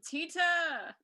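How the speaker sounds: calling out a name; yelling across the room. Pretend. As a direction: shout the name to someone far off. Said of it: "yell across the room".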